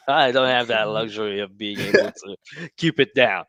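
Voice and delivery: laughing as he talks. Real, not acted. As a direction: talk through a laugh. Laughing about a sneeze.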